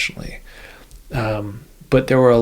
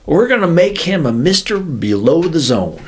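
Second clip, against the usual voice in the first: imitating Southern accent; 6 semitones higher, 6 dB louder than usual. Retelling the director of operations' plan, in a mock Southern accent. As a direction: ham up the officer's thick Southern accent. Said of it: "I remember his Southern accent".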